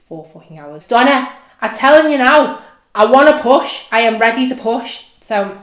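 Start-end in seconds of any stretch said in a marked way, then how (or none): 0.9-5.0 loud high demand, no arguing; desperate yell at the midwife; insistent shout. Retold or imitated. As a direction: insist you're ready, nearly shouting.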